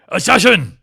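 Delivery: loud, high warning cry; alarmed shout. Acted. Sadeas bursts in warning.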